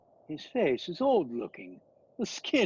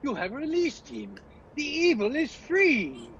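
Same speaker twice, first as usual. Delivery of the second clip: horrified outcry; 10 semitones higher than usual. Acted. Van Helsing crying out at Mina for freeing Dracula, horrified. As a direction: cry out in horror at her.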